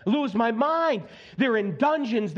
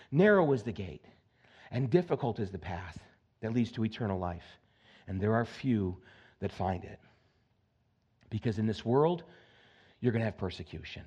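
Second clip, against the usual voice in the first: hushed scripture reading; 12 semitones lower, 6 dB quieter than usual. Acted. Preacher quoting Jesus to the church, low and hushed. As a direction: drop down and lay the verse out gently.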